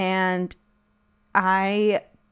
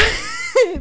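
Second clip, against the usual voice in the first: high squealing laugh; burst of laughter; 14 semitones higher than usual. Real, not acted. Laughing while telling about the talk.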